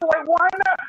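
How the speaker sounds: high, lightly voiced question; puzzled star. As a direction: pop star asking why not. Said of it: "she was like".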